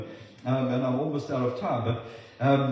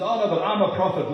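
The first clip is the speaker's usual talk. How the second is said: louder, higher protest; insistent objection; 5 semitones higher, 7 dB louder than usual. Acted. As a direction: push back loudly as a self-declared prophet. Self-declared prophet insisting, acted.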